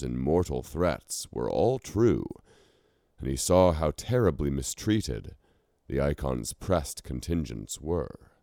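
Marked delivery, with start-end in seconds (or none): none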